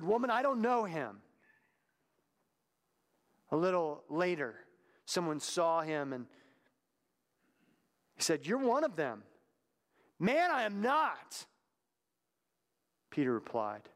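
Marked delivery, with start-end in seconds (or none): 0.0-1.2 high, pressed, louder denial; insistent denial. Acted. Peter denying to the servant girl, high insistent denial. Peter denies knowing Jesus. 8.5-9.2 high, louder accusing voice; pointed accusation. Acted. Someone in the courtyard accusing Peter, high pointed accusation. 10.2-11.5 high, slow, strained protest; emphatic denial. Acted. Peter protesting to his accuser, high slow strained denial.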